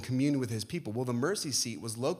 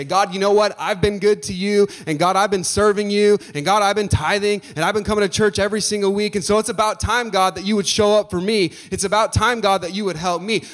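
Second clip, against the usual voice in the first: loud, higher, self-righteous prayer; proud demanding prayer; 6 semitones higher, 7 dB louder than usual. Retold or imitated. Preacher mocking a self-righteous prayer, loud and high. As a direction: demand God's help as if owed. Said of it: "Hezekiah does not come before the Lord and say".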